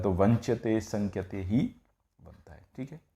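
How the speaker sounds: normal talking voice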